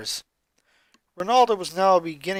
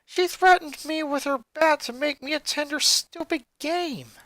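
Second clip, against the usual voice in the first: put-on higher boy's voice, troubled; a young boy telling his story, raised pitch; Lincoln's voice; 10 semitones higher than usual. Boy confiding to his friend, higher boyish voice. Lincoln complains about Lynn.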